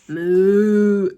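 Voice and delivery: loud cow moo. Acted. Cows scatter from the van, loud moo. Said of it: "cried the cows".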